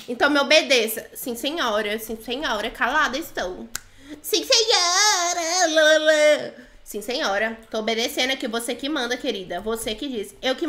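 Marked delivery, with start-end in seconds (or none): 4.2-6.5 high, drawn-out singsong; mock obedience. Player mocks an order to obey, in exaggerated singsong. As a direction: drag it into a silly held note. Zomba da ordem de obedecer.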